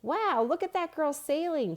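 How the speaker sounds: high, loud wow of wonder; excited girl. A narrator's reading. Girl spotting a sailboat with friends, high delighted wow. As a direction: gasp in delight at the sail. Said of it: "Stephanie said".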